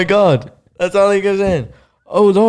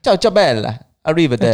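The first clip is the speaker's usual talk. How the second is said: higher mock-Italian flourish; Italian accent; 4 semitones higher, 4 dB louder than usual. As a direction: toss it out in a put-on Italian accent. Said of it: "You do an Italian voice there".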